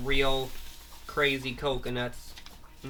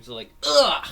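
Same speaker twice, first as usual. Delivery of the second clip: 1 pained grunt; 13 semitones higher, 9 dB louder than usual. Retold or imitated. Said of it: "bumping her head".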